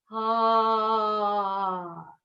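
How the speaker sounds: long open "ah" sigh, gently falling. Shown as a demonstration. Coach stretching the ribs, long voiced sigh. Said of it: "and sigh out".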